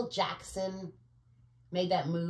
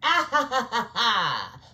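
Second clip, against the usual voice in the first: mock villain laugh; 2 semitones higher, 7 dB louder than usual.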